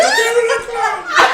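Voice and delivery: high shriek broken by laughter; laughing mock scream. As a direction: scream it while cracking up. Repeat of mother's scream.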